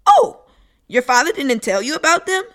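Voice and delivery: put-on shocked little kid; surprised child.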